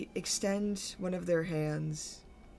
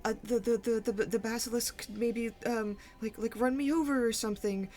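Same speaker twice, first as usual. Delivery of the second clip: high, panicked stammering; pleading; 7 semitones higher, faster than usual. Acted. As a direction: stammer frantically, pitch climbing at the end. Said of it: "soft voice to, like, plead with Mauve".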